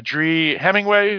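ordinary talk

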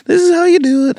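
high, loud put-on mentor voice; nice prophet. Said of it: "nice prophet somewhere who was like".

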